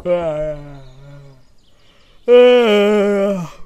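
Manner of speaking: long, loud open yawn; real yawn. Real, not acted. Player apologising for yawning, a big drawn-out yawn. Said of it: "sorry about my yawning".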